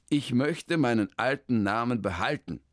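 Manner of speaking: a hint of a flustered man; lightly voiced, hesitant man; Hermann. Hermann stumbles over his long new name, lightly voiced.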